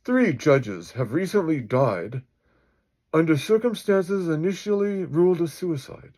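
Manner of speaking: high, loud newsreader delivery; breaking news anchor. Acted. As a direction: read it as urgent breaking news. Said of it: "cuts to the media breaking news".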